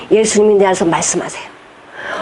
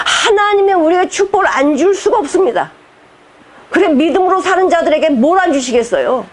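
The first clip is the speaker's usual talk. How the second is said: ordinary talk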